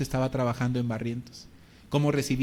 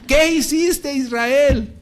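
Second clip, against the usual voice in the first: much higher and louder, shocked; alarmed demand; 11 semitones higher, 9 dB louder, much slower than usual. Retold or imitated. Pastor hears Israel is jailed, loud high alarmed question.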